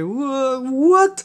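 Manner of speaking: put-on high, confused small-child "what?"; little girl voice. The woman regressed to a child, imitated confused toddler. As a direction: imitate a dazed three-year-old girl. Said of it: "como si fuera una niña de tres años".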